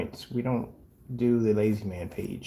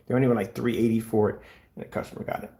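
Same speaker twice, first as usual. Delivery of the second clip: normal talking voice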